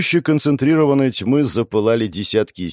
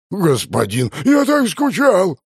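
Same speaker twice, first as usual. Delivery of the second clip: tearful monster voice; 9 semitones higher, slower than usual. Acted. Cow boss tearful reunion.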